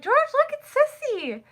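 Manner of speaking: very high, excited mom voice; high-pitched home-video cooing; imitated doting mother. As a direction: call someone over to admire the baby.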